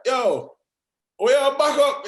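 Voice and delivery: high, loud mock holler; calling out. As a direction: imitate neighbours yelling at a driver backing in. Retold neighbours shouting to driver.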